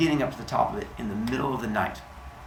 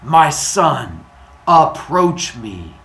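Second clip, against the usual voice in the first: loud, grand god voice, higher than usual; booming voice; 5 semitones higher, 10 dB louder, much slower than usual. Acted. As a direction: boom it out in stately, separated phrases. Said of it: "the booming voice goes".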